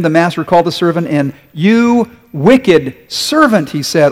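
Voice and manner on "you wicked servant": angry rebuke. Acted. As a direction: spit out each word in judgement. Master rebukes servant.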